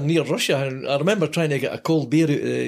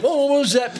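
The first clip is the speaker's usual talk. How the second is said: high, loud mock backing-down; mock sheepish; 13 semitones higher, 4 dB louder than usual. Teammates sheepishly backing off, voiced high and loud. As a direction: squeak out the excuse. Players falling silent.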